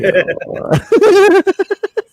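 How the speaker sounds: high, loud burst of laughter. Real, not acted. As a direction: crack up at your own joke. Laughs at own bit.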